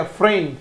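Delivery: फ्रेंड as "fren", the "d" swallowed; short final D. Shown as a demonstration. Teacher shows pupils फ्रेंड, D cut short. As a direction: clip the ड off the end of फ्रेंड. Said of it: "डी को short बोला जाता".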